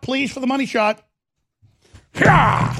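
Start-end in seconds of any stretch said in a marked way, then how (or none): 2.1-2.7 triumphant yell. Real, not acted. Triumphant cry after smashing the Roku.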